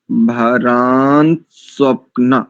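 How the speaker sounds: भरान स्वप्न very slowly, every half letter heard; slow pronunciation demo. Shown as a demonstration. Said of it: "तो यहां देखो".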